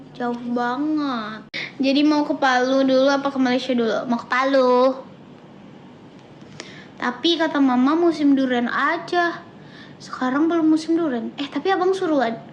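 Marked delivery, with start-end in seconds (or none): 1.8-12.4 loud, eager chatter; loud excited. Real, not acted. Telling viewers eagerly and loudly about going to Palu. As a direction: burst out with excitement about the trip.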